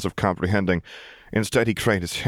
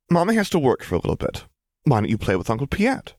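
coaxing mother; 7 semitones higher than usual. Acted. Mother coaxes her child.

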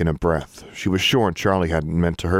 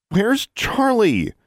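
high, slow, insistent demand; much slower than usual. A narrator's reading. Girl demanding to know where her raven went. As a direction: insist on an answer this time. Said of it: "she demanded a second time".

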